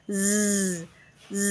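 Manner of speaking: "z" as a long voiced buzz; sustained buzzing "z"; phonics sound. Shown as a demonstration. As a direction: say "z" as zzz, not the letter name. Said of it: "ออกเสียง".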